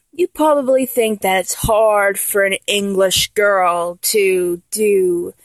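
ordinary talk

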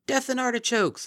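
booming high shout; bellowing. A narrator's reading. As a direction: bellow it out at the top of your lungs.

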